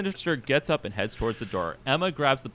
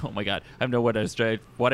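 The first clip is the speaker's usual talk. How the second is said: usual speaking voice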